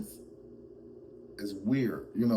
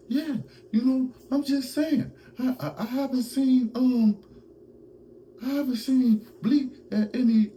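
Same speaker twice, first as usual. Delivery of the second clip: very high-pitched, loud comic impersonation; Yayo voice; 5 dB louder than usual. Acted. Host mimics Yayo answering Bleak, high squeaky impression. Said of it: "I'ma do the Yayo voice".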